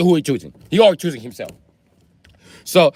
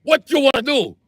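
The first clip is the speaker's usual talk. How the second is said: high-pitched yelling; 11 semitones higher than usual. Retold or imitated. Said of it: "I said".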